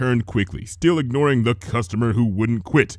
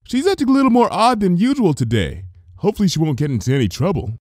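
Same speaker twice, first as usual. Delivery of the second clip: cheery greeting; 8 semitones higher, faster than usual. A narrator's reading. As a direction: call out brightly as a baker. Missus Cake voiced.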